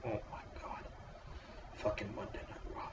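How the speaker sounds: breathy whisper of disbelief; voiceless groan of despair; exasperated whisper. Real, not acted. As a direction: whisper it, worn out. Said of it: "exasperated after sigh".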